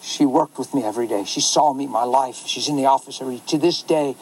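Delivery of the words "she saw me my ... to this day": ordinary delivery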